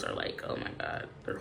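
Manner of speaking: breathless whisper under her breath; whispered aside. Real, not acted. Reader complaining to viewers about her nails, whispered aside.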